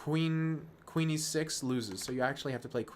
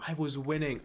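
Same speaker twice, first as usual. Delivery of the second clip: usual speaking voice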